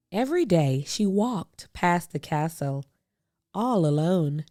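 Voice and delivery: ordinary delivery, nothing marked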